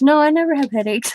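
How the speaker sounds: put-on high-pitched child's denial; high voice imitating a child; imitated little girl. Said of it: "She goes".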